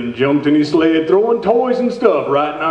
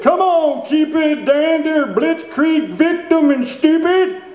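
put-on high drunken Santa calling his team; high, hammed-up shout at the reindeer; 9 semitones higher than usual. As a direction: holler the reindeer names like a tipsy Santa. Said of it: "he belched and shouted".

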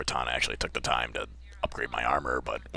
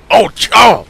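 sudden high yelp of shock, much louder; startled yelp; 19 dB louder than usual. Real, not acted. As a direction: jump out of your skin and yelp. Sudden attack startles him.

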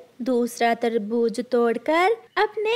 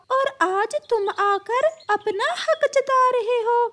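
a high, huffy parrot claiming the grain; shrill-high angry parrot; squeaky parrot; 10 semitones higher than usual. Acted.

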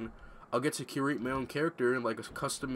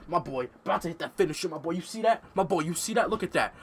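loud, high, fast hype over the game; hyped shouting; 4 semitones higher, 6 dB louder, faster than usual. Real, not acted. Gamer hyping his finisher to his sister, shouting excitedly. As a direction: yell it like you just landed the big move.

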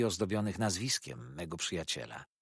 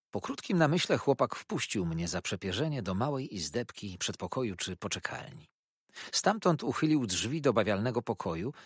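ordinary delivery, nothing marked

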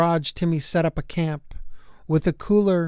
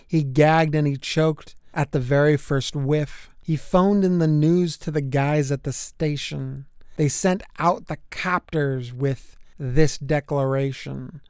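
usual speaking voice

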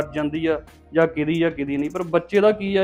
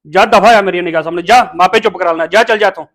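loud barked orders; angry dismissal; 2 semitones higher, 7 dB louder than usual. Acted. Man sending mother out of his sight, angry. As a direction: order her out loudly.

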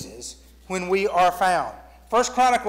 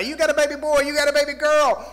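excited; 6 semitones higher, 7 dB louder than usual. Retold or imitated. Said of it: "he gets all excited".

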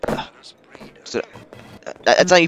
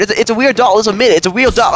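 louder, higher insistence; emphatic insisting; 6 semitones higher, 5 dB louder than usual. Real, not acted. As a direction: push the point eagerly. Insisting doll is weird.

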